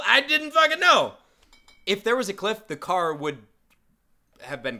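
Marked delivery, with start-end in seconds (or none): 0.1-1.1 high, loud defensive outburst; indignant cry of innocence; exasperated protest. Real, not acted. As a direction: defend yourself loudly against blame. Protests being blamed for the crash.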